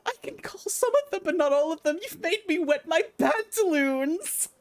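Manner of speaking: put-on high, fast, panicky child voice; scared boy leader. Boy leader stammers terms to the warriors, high and scared. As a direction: babble it in terror.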